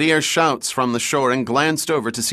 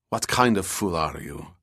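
a stern French sailor, growling the question; French accent, lightly voiced by the narrator; slower than usual. A narrator's reading. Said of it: "he asked in a French accent".

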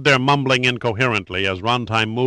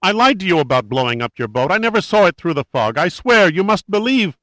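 pleading that climbs higher and louder; panicky confession; 8 semitones higher, 4 dB louder than usual. Acted. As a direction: beg to be believed, rising into feigned panic. Captive feigning terror, begging to be believed.

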